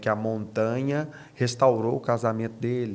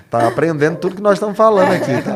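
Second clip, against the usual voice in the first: laughing; 8 semitones higher, 10 dB louder, faster than usual. Real, not acted. Laughs at AI interruption.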